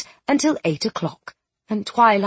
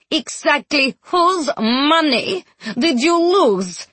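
high, drawn-out fury; slow, piercing demand from a furious wife; shattering-glass voice; 6 semitones higher, slower than usual. A narrator's reading. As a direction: draw out each accusing word, high. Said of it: "in a voice like shattering glass".

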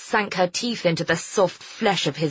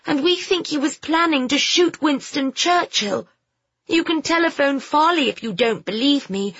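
put-on high, earnest young lady's voice; determined girl; 9 semitones higher than usual. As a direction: insist like a brave girl nobody believes. Phoebe pleads with airman.

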